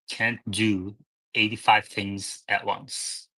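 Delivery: ordinary talk, nothing marked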